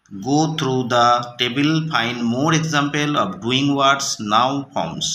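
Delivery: slow, deliberate English read aloud. Shown as a demonstration. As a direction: read each word slowly for the class. Teacher reading English slowly. (ধীরে ধীরে পড়া)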